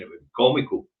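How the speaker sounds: ordinary delivery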